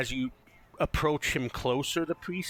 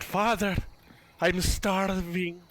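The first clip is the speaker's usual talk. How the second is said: higher, drawn-out mock wail; young man crying out; 7 semitones higher, slower than usual. Game master repeating the starving man's cry, plaintive call. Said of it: "a young man's voice cry out".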